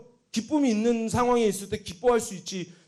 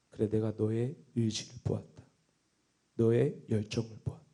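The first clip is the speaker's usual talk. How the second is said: low, soft voice of God; God speaking; 9 semitones lower, 7 dB quieter than usual. Retold or imitated. God answering the preacher's prayer, quiet and solemn. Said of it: "하나님 제 마음에 딱 들어오시면서 말씀하시는 것이".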